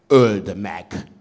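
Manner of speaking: a single voice rumbles out the demand; rumbling call. A narrator's reading. Lone voice in the crowd urging the emperor, rumbling. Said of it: "zúgta egy hang".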